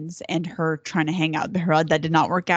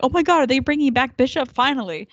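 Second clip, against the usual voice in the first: high, excited retold exclamation; excited reaction; 8 semitones higher, 4 dB louder than usual. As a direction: replay your fan excitement, pitched up. Acts out own excited reaction.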